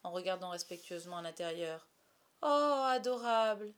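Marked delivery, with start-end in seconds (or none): 2.4-3.7 loud, slow, drawn-out gush; higher, rapturous coo at full volume; adoring exclamation. A narrator's reading. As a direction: gush in delighted wonder. Boy admiring the sleeping Wendy.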